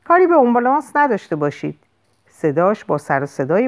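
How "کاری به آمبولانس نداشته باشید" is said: quick, higher-pitched warning; narrated shout. A narrator's reading. Sam warning the gang off the ambulance, mild shout. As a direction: call it out fast, moderately raised. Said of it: "رو به اونها فریاد کشید".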